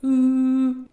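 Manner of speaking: a high sung "Ooh" trying to match a whistle; failed pitch match. Shown as a demonstration. Friend shows how his voice can't match a whistle, high sung "Ooh". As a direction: croon "Ooh" way up high, straining to hit the note. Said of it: "but if I was like".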